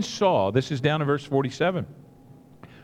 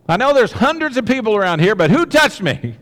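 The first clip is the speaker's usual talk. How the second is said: louder and higher, a little fast; acted loud insistence; 5 semitones higher, 6 dB louder than usual. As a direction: insist over the crowd. Jesus pressing the question.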